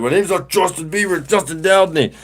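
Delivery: put-on high, insistent retort of a correcting teen; indignant correction. [name] correcting the guy who keeps calling him [name], high indignant retort. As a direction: snap back, fed up with the name joke.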